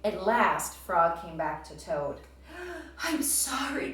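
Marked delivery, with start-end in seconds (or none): 2.5-3.0 sharp gasp for air; out-of-breath gasp. A narrator's reading. Frog runs back to Toad, gasping for air. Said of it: "gasped Frog". 3.0-3.9 breathless higher apology; gasped. A narrator's reading. Frog apologizes to Toad, out of breath and gasping. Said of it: "gasped Frog".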